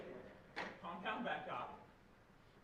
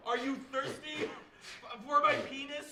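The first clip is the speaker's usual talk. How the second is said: loud bar voice, calling out; shouting across bar; 5 semitones higher, 9 dB louder, slower than usual. Acted. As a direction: yell it across a noisy bar.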